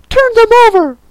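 very high, loud shrieked command; shrill royal fury; angry Queen. Acted. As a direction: scream an order at a servant. Angry Queen orders the knave.

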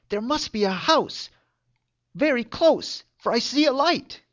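a rooster complaining he will be soup; proud rooster. Acted.

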